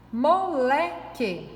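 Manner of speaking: "Moleque" slowly split into three syllables. Shown as a demonstration. Teacher counting syllables for pupils, "Moleque" said syllable by syllable. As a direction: stretch each syllable of "Moleque" apart. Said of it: "tem três sílabas".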